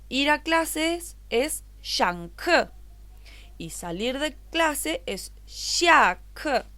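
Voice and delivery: shang ke and xia ke said slowly and clearly; slow explanation. Shown as a demonstration. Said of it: "si yo quiero preguntar". (explicación lenta de shang ke y xia ke)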